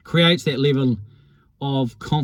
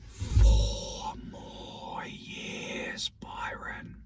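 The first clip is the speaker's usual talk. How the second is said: four more years whispered, slow and soft; whispered taunt; 11 dB quieter, much slower than usual. Retold or imitated. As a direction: hiss the Gregan taunt under your breath. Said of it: "The classic Georgie Gregan line".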